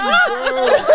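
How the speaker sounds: high, loud exasperated cry through laughter; mock-outraged yell; indignant outburst. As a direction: shout it in laughing disbelief. Retold indignant reply to supervisor.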